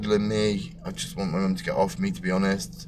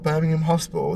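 surprised; 9 semitones higher, faster than usual. Retold or imitated. Incredulous at the letter.

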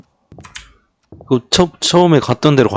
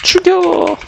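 panicked cry; much slower than usual. Real, not acted. Caught by the witch.

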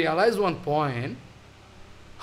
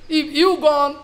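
pleading grief; 8 semitones higher, 5 dB louder than usual. Acted. Mahaprabhu grieving to Haridas.

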